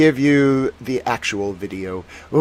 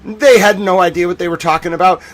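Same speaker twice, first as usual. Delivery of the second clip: usual speaking voice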